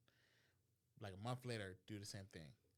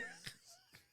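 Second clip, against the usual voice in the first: squealing laugh; high cracking laugh; 11 dB quieter than usual. Real, not acted.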